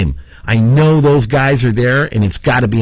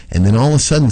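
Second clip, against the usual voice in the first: ordinary talk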